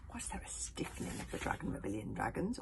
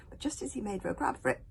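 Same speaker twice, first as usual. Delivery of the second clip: plain reading voice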